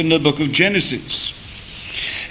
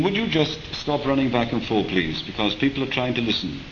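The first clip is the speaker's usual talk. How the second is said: quiet aside; 3 semitones lower, 6 dB quieter than usual. Real, not acted. Preacher asks Jonathan to stop running, softer aside. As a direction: drop the voice for a quick aside.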